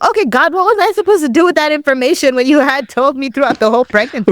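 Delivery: high-pitched, put-out challenge to God; exasperated questioning. Retold or imitated. Woman questioning God about the withheld information, exasperated.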